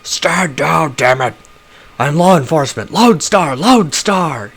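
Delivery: high, loud desperate yelling; hoarse roar. Acted. Duncan shouting at the police, hoarse roar. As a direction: roar to be heard over the gunfire. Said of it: "his voice comes out in a hoarse roar".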